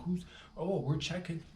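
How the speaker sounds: high, cocky inner voice; inner mock bravado. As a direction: play it like a silent trash talk. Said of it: "is what I said inside my head".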